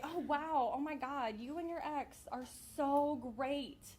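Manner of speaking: hammed-up wowed compliment; gushing mom friends. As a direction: gush with exaggerated admiration, imitating a friend. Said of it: "mom friends and".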